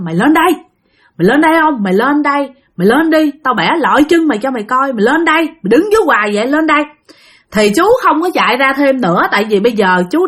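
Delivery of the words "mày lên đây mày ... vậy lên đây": ghost cursing from shore. Retold or imitated. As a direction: dare him to come up and get hurt. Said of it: "nó chửi chú".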